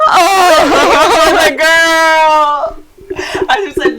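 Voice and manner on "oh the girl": loud, high, laughing cry; laughing shout. Real, not acted. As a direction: shriek it through laughter. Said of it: "laughing at her own defeat".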